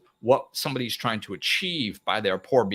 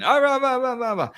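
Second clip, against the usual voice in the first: high, loud blah-blah ranting; mocking blah blah in a high voice; mock ranting gibberish; 13 semitones higher, 5 dB louder than usual. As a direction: parody someone yelling nonsense.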